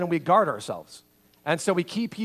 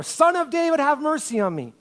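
loud, high desperate shout; 10 semitones higher, 4 dB louder than usual. Acted. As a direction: shout over the crowd. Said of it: "he shouted all the more".

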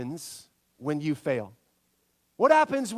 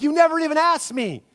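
higher, louder indignant protest; half-playful exasperation, pitched up and loud; mock indignant complaint; 8 semitones higher, 5 dB louder than usual. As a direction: act out the roommate's outrage at borrowed clothes. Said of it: "And you're like".

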